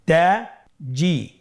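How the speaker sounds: "তাজি" very slowly in two separate syllables; syllables apart. Shown as a demonstration. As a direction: split "তাজি" with a clear pause between syllables. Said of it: "এভাবেও পড়তে পারেন". ("তাজি" ভেঙে ভেঙে ধীরে)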